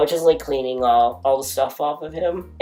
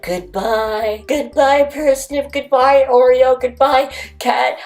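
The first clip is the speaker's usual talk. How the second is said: sing-song goodbye to cats; 12 semitones higher, 6 dB louder than usual. Real, not acted. Volunteer bids the shelter cats a loving high goodbye. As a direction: coo a fond farewell to pets.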